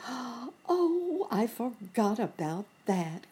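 high, drawn-out dismayed "oh"; sad dismay. A narrator's reading. Nurse Jane realising she forgot the squeezer, sad dismay. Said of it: "sadly like".

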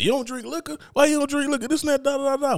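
high, shocked questioning; mock disbelief. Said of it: "they be like".

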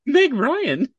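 high and loud incredulous question; surprised. Real, not acted. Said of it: "It was just the way you said it".

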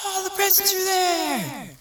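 put-on excited kid shout. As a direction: shriek it as you run to the tree. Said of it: "Then you run".

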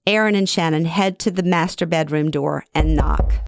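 ordinary delivery

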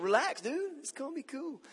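mock chilled-out dude; laid-back dude. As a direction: play the carefree surfer telling everyone to relax. Voicing his chill-out motto.

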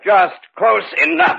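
high shout as he closes in; triumphant threatening shout; menacing shout. Acted. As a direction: bark it, closing in for the kill. Killer closes in on victim.

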